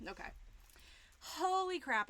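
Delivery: ordinary talk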